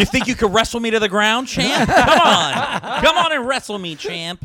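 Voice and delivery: laughing taunt, high and loud; mock creepy coach. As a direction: ham up a creepy coach daring you.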